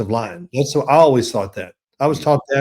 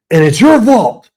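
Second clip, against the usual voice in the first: high, loud accusing burst; imitated angry coach; 7 semitones higher, 8 dB louder than usual. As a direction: turn on him and blame him loudly. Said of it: "he was mad at the offensive line".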